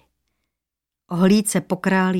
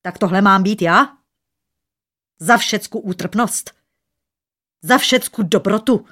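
loud, wounded indignation; indignant; 2 semitones higher, 6 dB louder than usual. A narrator's reading. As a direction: throw outraged questions with rising stress. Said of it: "rozhořčený hlas mu přeskakoval v hrdle". (rozhořčeně, pohoršeně)

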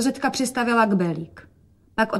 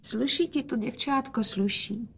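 soft, higher-pitched murmur; quiet; 3 semitones higher, 7 dB quieter than usual. A narrator's reading. Father compliments his dressed-up girl, quietly. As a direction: praise softly in a lifted voice. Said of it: "hovořil otec tiše".

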